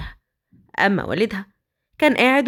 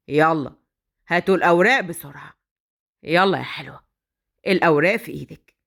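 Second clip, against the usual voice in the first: menacing kidnapper; 6 semitones lower than usual. Acted. Badr threatening the captive girl, low and smug. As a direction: savour his power over her.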